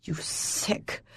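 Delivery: a whispered hiss; betrayed woman hissing at her lover. A narrator's reading. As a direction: breathe it out through trembling lips. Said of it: "through trembling lips I hiss".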